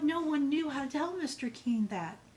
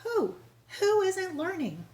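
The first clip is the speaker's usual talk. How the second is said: high, louder anxious questions; worried; 9 semitones higher, 4 dB louder than usual. Acted. Principal fretting over an unlearning pupil, high worried pleading. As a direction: press her anxiously for a name. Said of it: "He looked very worried".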